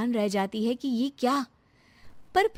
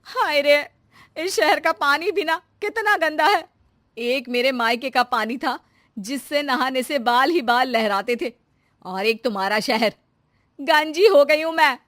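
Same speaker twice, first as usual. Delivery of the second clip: loud, higher whiny lament; complaining wife; 4 semitones higher, 5 dB louder than usual. Acted. Shanti complaining to her husband, loud whiny lament. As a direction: wail about her lost hair. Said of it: "वो अपने पति से कहती है".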